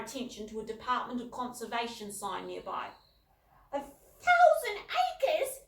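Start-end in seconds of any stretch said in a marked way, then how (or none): 3.7-5.6 very high, slow squeal of delight; delighted squeak. A narrator's reading. Little sister squeaking at the thousand acres, very high and slow. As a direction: squeak it in disbelief. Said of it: "squeaked Rubella, delighted".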